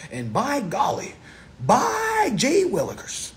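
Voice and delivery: overplayed golly-gee showman; hammed-up folksy exclamation. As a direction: camp it up like a country preacher. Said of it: "I am definitely performing".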